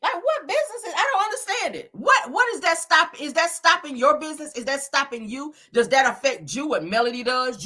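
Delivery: rising, baffled rant at the hater; exasperated disbelief. Real, not acted. Exasperated ranting at critic.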